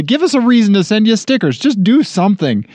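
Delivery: high-pitched agitated insistence; worked-up, angry-sounding. Real, not acted. Host begging listeners for a reason to send stickers, angry-sounding. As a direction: plead with rising exasperation, pitched well up. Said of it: "Why are you getting angry all of a sudden".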